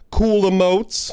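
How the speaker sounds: slow, loud and stretched; drawn out. Acted. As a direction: stretch every sound out. Stretched out for effect.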